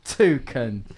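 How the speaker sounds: ordinary talk, nothing marked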